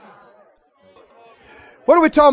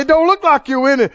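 ordinary talk, nothing marked

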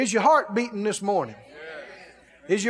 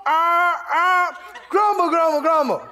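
mock grumbling; 7 semitones higher, 4 dB louder than usual. Mocking grumblers in sermon.

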